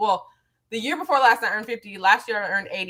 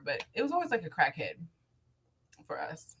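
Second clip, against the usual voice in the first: whispered aside; 7 semitones lower, 10 dB quieter than usual. Real, not acted. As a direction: drop to a whisper at the end. Whispered confession.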